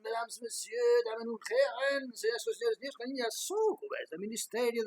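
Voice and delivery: booming circus ringmaster announcing. Acted. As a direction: open like a showman presenting the act. Circus-style opening address to audience. (presentador de circo)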